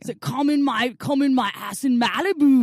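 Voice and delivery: laughing through a hammed Austrian accent; Schwarzenegger impression. Joking innuendo as Schwarzenegger, laughing in an Austrian accent. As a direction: ham the Austrian accent, cracking up.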